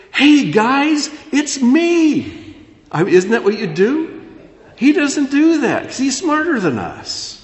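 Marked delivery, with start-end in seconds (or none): 0.1-2.5 very high, loud excited call; hammed-up, giddy hello to long-lost family; eager reveal. Us playing Joseph revealing himself to brothers, excited.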